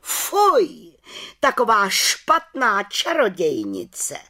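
high, slow, scandalised exclamation; head witch shocked and indignant; indignant outcry. Acted. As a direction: sound truly outraged by her. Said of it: "pohoršeně vykřikla".

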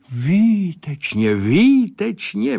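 high, drawn-out cry of approval; exultant, stretched exclamation; delighted calling out. A narrator's reading. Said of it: "volal Sládek".

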